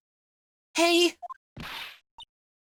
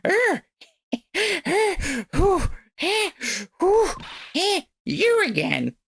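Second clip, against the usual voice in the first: put-on hunting for an old lady voice; lightly voiced old granny; 6 semitones higher, much slower than usual. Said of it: "where's my grandma voice".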